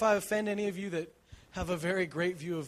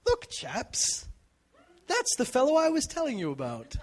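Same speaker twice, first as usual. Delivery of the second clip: high, polite posh English gentleman voice; mock posh British; 7 semitones higher, 5 dB louder than usual. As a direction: politely announce him like a proper English chap. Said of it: "he doesn't go".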